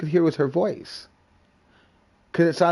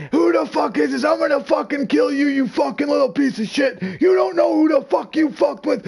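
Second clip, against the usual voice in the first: high-pitched, escalating mock tirade; mad rant; 8 semitones higher than usual. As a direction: imitate a man losing his temper on the phone. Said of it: "then he started getting mad".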